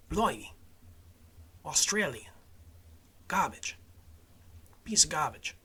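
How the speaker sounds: single words tried out in bad Australian; failed Australian accent. Podcaster giving up on his attempt, bad Australian accent. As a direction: practise a poor Australian accent word by word. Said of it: "Can't do Australian".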